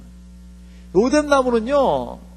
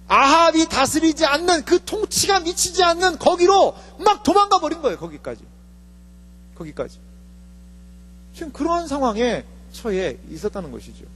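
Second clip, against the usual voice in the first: usual speaking voice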